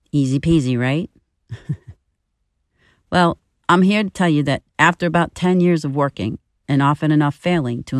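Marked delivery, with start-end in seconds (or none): none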